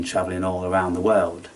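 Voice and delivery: ordinary talk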